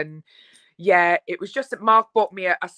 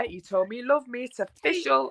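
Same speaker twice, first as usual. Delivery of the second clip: normal talking voice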